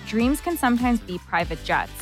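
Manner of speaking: ordinary talk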